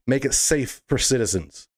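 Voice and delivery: ordinary delivery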